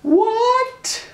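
very high stunned what; high drawn-out disbelieving what; mock astonished what. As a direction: mimic classmates' shock. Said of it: "Everybody's like".

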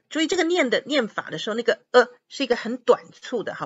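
ordinary talk, nothing marked

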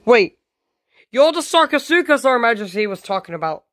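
high, fast shocked realisation; alarmed recognition. Pretend. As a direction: blurt it in play-acted alarm. Victim recognises the villain.

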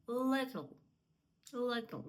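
"little" with an unsoftened first L; "little" opening on a hard, dark L. Shown as a demonstration. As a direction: say "little" with a firm L and short i. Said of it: "твердіше".

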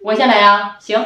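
ordinary talk

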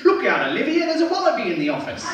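put-on high-pitched caricature of an Australian accent; mock Australian accent. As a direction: put on an exaggerated, high Aussie voice. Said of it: "they just assume that we sound like this".

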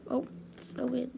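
ordinary talk

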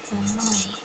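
ordinary delivery

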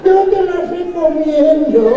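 high, slow, put-on little old man; hammed-up old man endorsement. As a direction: croak it like the old pharmacy mascot. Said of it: "salía el chingá viejito".

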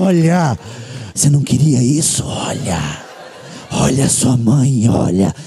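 low, slow, whispery demon voice; Satanás. Acted. Satan urging the son to look, low whispery voice. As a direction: tempt like a low demon at someone's ear. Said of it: "o Satanás veio no meu ouvido e falou".

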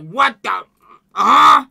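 mock frustrated rapper, building to a loud "huh?"; imitated frustration. Reactor mimics the frustrated rapper on the track, mock exasperation. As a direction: act out his exasperation, punching the last word. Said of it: "all the frustration in his voice".

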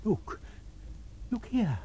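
put-on high hushed old woman; old woman whispering. A narrator's reading. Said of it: "whispered an old woman".

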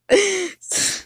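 breaking into high giggles; can't hold back a laugh; sudden high giggle mid-story; giggle fit. Real, not acted.

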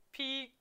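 "pecan" as PEE, pause, KANE; Aussie pronunciation. Shown as a demonstration. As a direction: try Aussie "pecan" in two split syllables. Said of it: "Aussie pronunciation".

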